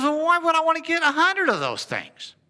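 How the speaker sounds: high-pitched, baffled protest; imitating a storekeeper's incredulous reply; incredulous objection. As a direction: balk at a silly suggestion. John balks at bulk offer.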